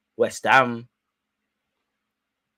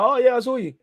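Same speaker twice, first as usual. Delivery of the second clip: mock awkward fan; 11 semitones higher, 4 dB louder than usual. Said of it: "You see me walk past and be like".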